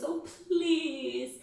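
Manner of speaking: high whiny please; pleading please. Shown as a demonstration. Wife begging her reluctant husband, whiny plea. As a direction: beg him with a stretched please. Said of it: "So Missus Bennet goes".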